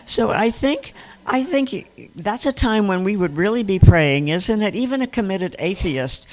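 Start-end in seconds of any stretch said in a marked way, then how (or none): none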